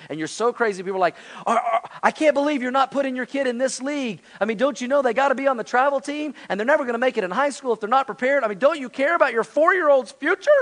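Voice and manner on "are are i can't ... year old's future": high-pitched, incredulous parent caricature; hammed-up disbelief, climbing higher toward the last question; mock outrage. Pastor mimics parents aghast about the kid's league, mock outrage.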